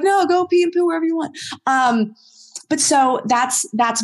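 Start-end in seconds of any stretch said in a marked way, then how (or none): 0.0-1.4 higher, quick careless dog voice; carefree little dog. Retold or imitated. Trainer voicing a tiny untrained dog, higher, fast and carefree. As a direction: toss it off like a tiny spoiled dog.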